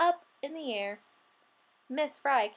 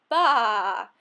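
goat bleat; 3 semitones lower, 7 dB louder than usual. Acted.

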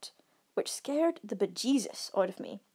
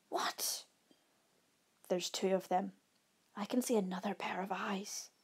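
softer, near-whispered alarm; hushed dread; 6 dB quieter than usual. A narrator's reading. Camper telling friend of second bear, hushed dread. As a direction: drop the volume as dread sets in.

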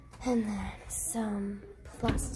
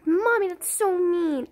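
put-on whiny kid voice; 6 semitones higher, 6 dB louder than usual. Child complains.

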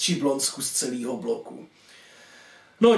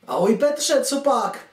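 higher-pitched mum answering her son; mother on phone; 6 semitones higher than usual. Acted. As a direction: pick up as his mum, pitched up. Mother answers son's phone call. (vyšší hlas mámy v telefonu)

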